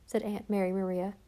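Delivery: plain reading voice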